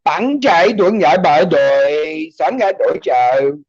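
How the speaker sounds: slow, drawn-out fake Chinese gibberish; mock Mandarin accent. Shown as a demonstration. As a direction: gibberish imitating Mandarin tones. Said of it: "like, Mandarin Chinese or something".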